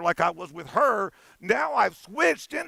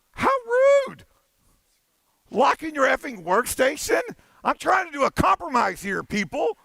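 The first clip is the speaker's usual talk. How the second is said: hammed-up indignation, rising higher on the question; mock outrage; 7 semitones higher than usual. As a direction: play fake offense at a locked screen.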